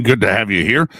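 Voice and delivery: ordinary talk, nothing marked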